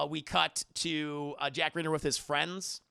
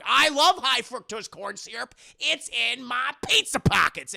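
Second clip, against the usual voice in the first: higher, louder, slow dopey excitement; goofy enthusiasm; 6 semitones higher, 7 dB louder than usual. Retold or imitated. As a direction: gush over junk food. Josh defends corn syrup.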